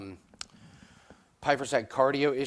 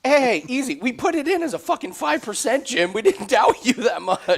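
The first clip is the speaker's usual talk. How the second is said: higher, louder mock-defensive objection; excited protest; 10 semitones higher, 6 dB louder than usual. Real, not acted. Co-host to yelling Jim about doubting him, loud protest. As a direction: protest loudly over his yelling.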